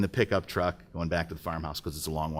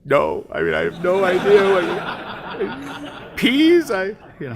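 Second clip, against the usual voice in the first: high, laughing and lost for words; flustered laughing; 9 semitones higher, 7 dB louder, slower than usual. Retold or imitated. Young Mike laughs, baffled by the boss's peas story. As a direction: laugh through the confusion.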